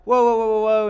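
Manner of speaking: loud, high soothing whoa to a storm; calming whoa. Retold or imitated. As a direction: call out to settle the storm. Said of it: "Jesus is like".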